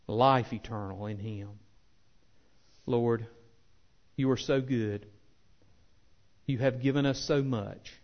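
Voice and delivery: unhurried, earnest pulpit prayer; closing prayer. Acted. Pastor praying for his church at the close, measured and earnest. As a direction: pray it sincerely over the congregation.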